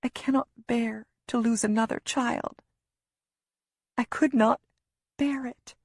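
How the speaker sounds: high, unvoiced, cracking whisper; breaking down. A narrator's reading. Mother telling Lewis she can't lose another child, tearful breaking whisper. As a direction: voice breaking into tears.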